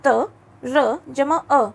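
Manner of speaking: त, र जमा अ drawn out piece by piece; very slow spelling out. Shown as a demonstration. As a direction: spell it out very slowly. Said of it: "अगला संयुक्त वर्ण है". (बहुत धीरे-धीरे अक्षर जोड़ते हुए)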